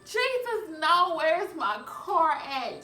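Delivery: high, loud retold panic, slowing down at the end; shrieking in disbelief at the empty spot. Finding her car gone.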